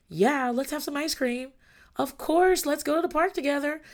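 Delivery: high, gleeful agreement; eager yes. Acted. Acting out parent saying yes.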